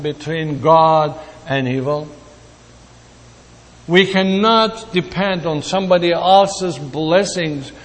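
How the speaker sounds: ordinary delivery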